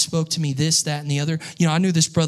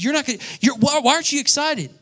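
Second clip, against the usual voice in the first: much higher, pressing disbelief; incredulous friend; 11 semitones higher, 4 dB louder than usual. Retold or imitated. Friend in the car demanding why he isn't excited, incredulous. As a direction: push him, amazed he isn't excited. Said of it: "He said".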